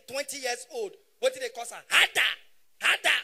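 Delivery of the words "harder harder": higher, louder egging on, hammed up; mock urging. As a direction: bark it as comic encouragement.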